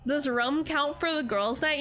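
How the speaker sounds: usual speaking voice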